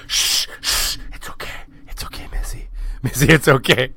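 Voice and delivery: calm her down in a whisper; mock soothing whisper to a waking wife; hushed reassurance. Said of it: "in her face going".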